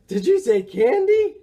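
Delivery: high, loud, eager character voice; excited. A narrator's reading. Morris hears Boris mention candy, excited question. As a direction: pounce on it with greedy excitement.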